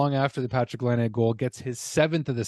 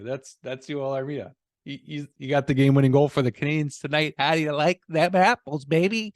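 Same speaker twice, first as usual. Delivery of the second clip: gloating put-on voice; 2 semitones higher than usual.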